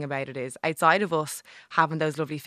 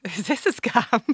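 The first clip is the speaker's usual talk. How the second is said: high, louder imitation of a wary stranger; mock suspicion; 7 semitones higher, 6 dB louder, slower than usual. Passer-by questioning a free gift, mock suspicious. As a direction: imitate a doubtful passer-by, pitched up.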